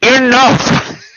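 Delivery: loud, high shout of Enough!. Acted. Player shouting in character to stop the hug. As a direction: bark it out to stop them cold. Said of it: "did you say enough?".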